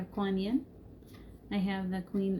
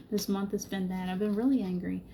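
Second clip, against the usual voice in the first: ordinary talk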